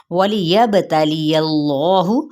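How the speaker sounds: measured tajweed chant; Quran recitation. Shown as a demonstration. Teacher reciting the verse for her students. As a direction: recite it with care, every letter clear. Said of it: "teacher recites Quran verse".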